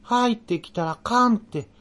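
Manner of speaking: 入ってきたらかんって, high and slow with a falling eerie tone; ghostly Nagoya-dialect warning, high and drawn out; muffled eerie warning. A narrator's reading. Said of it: "くぐもった私の声で". (「入ってきたらかんって」を高くゆっくり、こもった声で)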